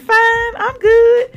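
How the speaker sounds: high, loud fake cheer; falsely fine. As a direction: overdo the pretence that all is well. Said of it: "And be lying".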